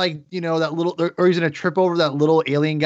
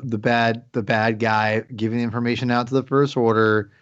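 normal talking voice

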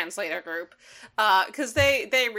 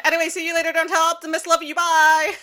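high, louder, carefree farewell; high breezy goodbye; 9 semitones higher, 7 dB louder than usual. Retold or imitated. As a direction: chirp it off like a carefree flyboy. Jetfire voiced, high and loud.

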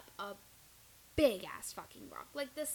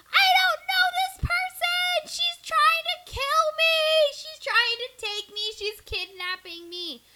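shrieking, much higher and louder, in terror; panicked screaming; 15 dB louder than usual. Retold or imitated. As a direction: scream it like you're being kidnapped. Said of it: "And she's yelling".

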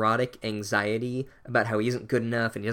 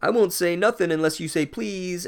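lightly voiced dorky dad, a bit higher and louder; hammed-up dad-joke taunt; 5 semitones higher, 4 dB louder than usual. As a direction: tease them like a corny dad. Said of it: "the world's dorkiest dad".

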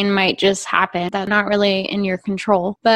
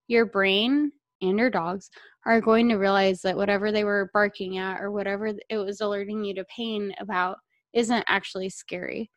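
normal talking voice

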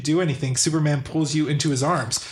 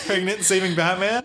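laughing; 3 semitones higher than usual. Real, not acted. Echoes title laughing.

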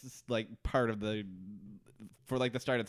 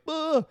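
mock scream; 5 dB louder than usual. Retold scream in the cave.

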